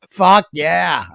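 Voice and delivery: mock-eager echo of a friend; playful gung-ho imitation; imitated eager cheer. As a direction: imitate a pal hyped for a night out. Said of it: "you just said that like".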